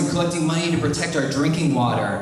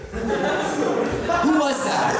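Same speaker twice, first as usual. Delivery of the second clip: high-pitched, drawn-out demand; challenging the crowd; 12 semitones higher, much slower than usual. Real, not acted. Comic to an unseen audience member, high challenging call. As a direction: call it out high to find the culprit.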